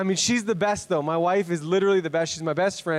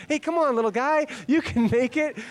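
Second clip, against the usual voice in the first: mock nurse; 8 semitones higher than usual. Mock nurse coaxing baby.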